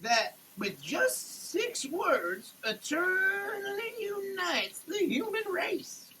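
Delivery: ordinary delivery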